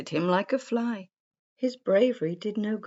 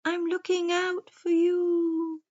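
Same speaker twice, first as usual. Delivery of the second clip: slow, high hooting owl; 12 semitones higher, slower than usual. A narrator's reading. Wise owl warns the forest creatures, slow high hooting. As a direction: draw it out in a high owl hoot. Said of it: "He blinked his big round eyes and said".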